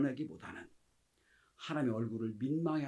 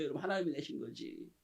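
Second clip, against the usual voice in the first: usual speaking voice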